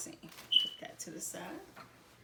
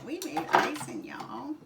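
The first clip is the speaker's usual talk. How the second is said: put-on country accent; 6 semitones higher, 4 dB louder than usual. To viewers, proud of the icing, in a playful country accent. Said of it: "Got my little country accent".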